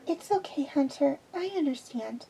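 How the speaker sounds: high and soft, forgiving; a child comforting kindly; gentle girl voice. Acted. Omega comforts Hunter.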